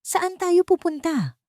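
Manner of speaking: high, slow, breathless question; asking while out of breath; panting. A narrator's reading. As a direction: gasp out the question, winded from the climb.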